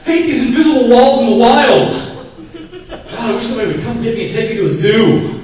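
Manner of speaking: high, loud cartoon animal voice; whining zebra complaining loudly; hammed-up cartoon zebra; startled zebra. Zebra voiced hitting invisible wall.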